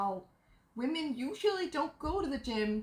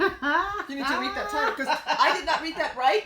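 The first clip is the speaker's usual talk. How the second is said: talking through laughter, louder; laughing; 4 semitones higher, 7 dB louder than usual. Real, not acted. Laughing while speaking.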